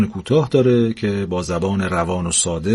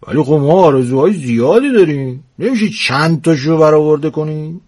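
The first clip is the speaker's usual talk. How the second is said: louder, higher pushy haggling; bargaining old husband; 6 semitones higher, 4 dB louder than usual. Acted. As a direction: haggle for more wishes.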